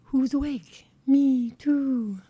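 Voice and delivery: hint of a great horned owl calling; owl hoot imitation. Host showing listeners the owl's call, lightly voiced hoot. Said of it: "they say".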